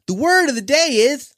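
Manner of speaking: very high, sing-song Pee-wee voice; Pee-wee Herman. Retold or imitated. Host imitating Paul Reubens' catchphrase in a squeaky voice. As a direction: chirp it like an excited man-child host. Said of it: "I do a better Paul Reubens than that".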